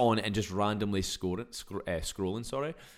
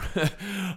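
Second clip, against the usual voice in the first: short high laugh at himself; real laugh at his own habit; high burst of laughter; 10 semitones higher, 4 dB louder than usual. Real, not acted.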